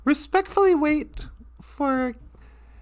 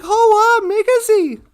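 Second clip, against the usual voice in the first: higher, louder cry to the eagle; excited call; 5 semitones higher, 6 dB louder than usual. Acted. Teddy bear flying with an eagle, excited call. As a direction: call out in wide-eyed excitement. Teddy calls out amid flight.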